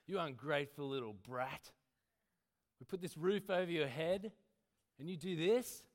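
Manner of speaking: shouting parent, high and loud; angry parent. Acted. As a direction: scold the kid furiously. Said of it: "the mind frame of my past".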